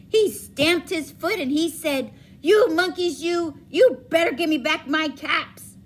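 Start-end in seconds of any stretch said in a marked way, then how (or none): 2.4-5.8 louder, higher angry demand; angry peddler. Acted. Angry peddler demanding his caps from the monkeys. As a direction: shake both fists as you demand. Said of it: "Now he felt quite angry".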